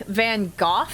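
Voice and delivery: "Van Gogh" ending in an f sound; British "Van Gogh", rhyming with cough; British pronunciation. Shown as a demonstration. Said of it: "the British pronunciation".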